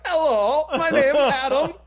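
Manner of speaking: high, loud goofy Adam Sandler voice; hammed-up silly-voiced greeting. Comedian mocks himself pitching, loud high impression. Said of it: "Oh, I'm doing an impression".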